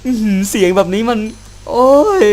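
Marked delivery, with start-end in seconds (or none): 1.7-2.2 sudden high yelp of fright; real startled cry. Real, not acted. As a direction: jump at the noise.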